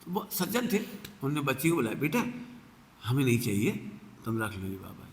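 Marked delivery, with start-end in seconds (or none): 1.9-5.1 gentle officer. Retold or imitated. Kind officer to child.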